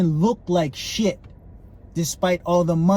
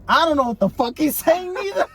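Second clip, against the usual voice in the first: quoting the guy beside him; 10 semitones higher than usual. Retold or imitated. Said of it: "He's like".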